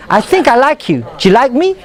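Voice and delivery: linked words with a rising question; connected, flowing speech; fluid and melodic. Shown as a demonstration. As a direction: let the words run together smoothly. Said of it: "It's very melodic, very fluid".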